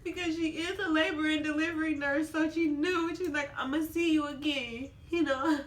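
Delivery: crying. Real, not acted. Mother explaining how the nurse knew, crying. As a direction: keep explaining while choked with tears. Tearfully retells nurse's parting words.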